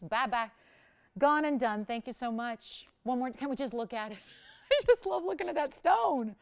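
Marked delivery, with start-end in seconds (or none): 4.4-6.4 laughing, higher and louder, admiring; giggling admiration; delighted laugh. Real, not acted. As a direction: gush with a laugh over the stone. Host admires the ring.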